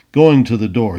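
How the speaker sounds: ordinary delivery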